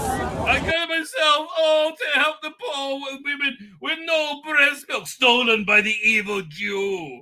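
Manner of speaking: high, hammed-up parody of the protester; mock him in a high, gushing voice; mocking impression. Mocking the pro-Palestine marcher clip.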